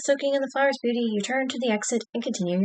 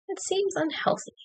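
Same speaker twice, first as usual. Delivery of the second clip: higher, softer and slower, skeptical; drawn-out, higher and softer dubious remark; doubtful aside; 7 semitones higher, 7 dB quieter, slower than usual. Shown as a demonstration. Reading a choice twice.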